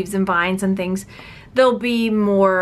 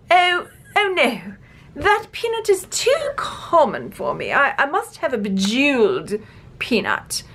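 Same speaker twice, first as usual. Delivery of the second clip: imitation of the Queen, grandly put on; posh queen; 3 semitones higher than usual. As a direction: play a fussy, demanding queen. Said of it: "The queen is like".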